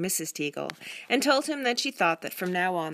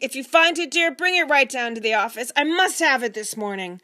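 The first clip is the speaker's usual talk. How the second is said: higher and louder, urgent; hurried call; 7 semitones higher, 8 dB louder than usual. Acted. Father calling to his wife while rushing for the bus, loud. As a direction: shout it back over your shoulder.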